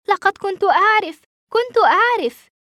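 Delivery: high, loud burst of relief; excited vindication. A narrator's reading. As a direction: cry it out, pitch leaping up. Boy vindicated by the news.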